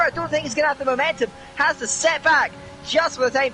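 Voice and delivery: animated motorsport commentary, easing at the end; excited live play-by-play. Real, not acted. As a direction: call each car through as it passes.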